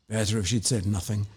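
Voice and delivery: plain reading voice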